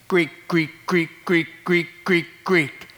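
deadpan repeated Greek, Greek; a lightly voiced dry roll call; mock roll call. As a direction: rattle off the list like a flat announcement. Mock reading of the names.

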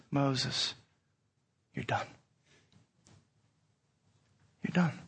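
low, soft and final; quiet verdict. Acted. Preacher voicing God to Moses, quiet and final. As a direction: hand down the sentence softly.

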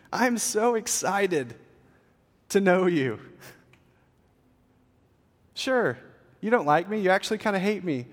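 high, loud, eager invitation; God calling out. Acted. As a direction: call them in with drawn-out, excited welcome. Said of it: "that's exactly what God's saying".

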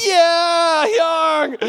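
high, loud delighted yell; excited shout. Retold or imitated. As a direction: shout back in joy. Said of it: "I'm like".

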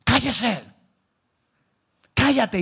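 short, sharp shushing commands; acted crowd telling someone off; mock hushing. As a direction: silence a noisy nuisance. Crowd hushing the shouting blind man.

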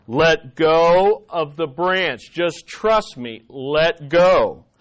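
slow, drawn-out, weighty instruction; calm voice of God, stretched out; slow, deliberate command. Acted. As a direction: spell it out slowly and firmly. God repeats order to Jack.

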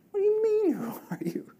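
an irritated whisper. Real, not acted. Preacher reacting to the Pharisees' question, irritated whisper. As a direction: hiss it under your breath, fed up. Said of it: "Now I'm irritated by it".

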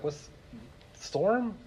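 a hint of a groggy voice just roused; a little dazed, half awake; groggy just woken. Jesus waking up in the boat, lightly groggy. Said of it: "picture of Jesus kinda like, rubs his eyes".

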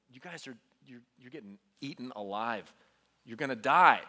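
low, hushed retold despair; broken, despairing. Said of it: "I was so broken for you guys".

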